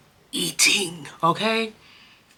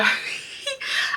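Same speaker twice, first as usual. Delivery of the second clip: teary laugh; 5 dB quieter than usual. Real, not acted. Laughing at her own tears.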